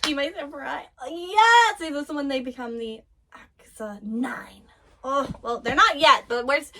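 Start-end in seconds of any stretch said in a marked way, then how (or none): 1.8-4.6 hushed whisper aside. Real, not acted. Streamer whispering lore to viewers, hushed. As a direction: whisper it as a secret.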